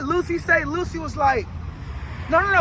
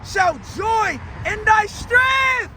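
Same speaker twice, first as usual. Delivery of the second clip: raised, drawn-out proclamation; forceful psalm preaching; 6 semitones higher, much slower than usual. Acted. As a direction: build to a stretched final shout.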